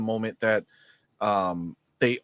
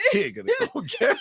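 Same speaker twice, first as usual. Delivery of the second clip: imitating a friend; faster than usual. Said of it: "Comedian's like".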